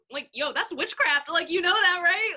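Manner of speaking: high, loud, amazed "that's witchcraft!"; incredulous exclaim. Retold or imitated. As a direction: blurt it out in disbelief. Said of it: "I was just like".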